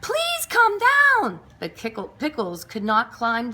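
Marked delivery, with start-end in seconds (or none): 0.0-1.4 high, slow pleading call. A narrator's reading. Missus Goodkind begging the cat to come down, high slow plea. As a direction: coax him down slowly. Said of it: "she called".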